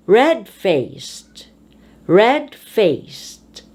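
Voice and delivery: "Red" strong in "Red-faced", "faced" dropping; compound stress. Shown as a demonstration. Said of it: "you emphasize the first word".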